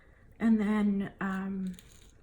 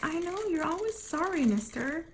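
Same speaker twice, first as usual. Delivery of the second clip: much higher, put-on scolding voice; mock scolding; 8 semitones higher than usual. Mother retelling how she scolded her son, much higher put-on voice. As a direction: tease him like a sing-song schoolmarm. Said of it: "And I was like".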